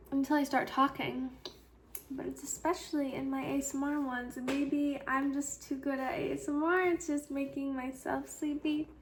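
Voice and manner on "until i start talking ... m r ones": ordinary talk, nothing marked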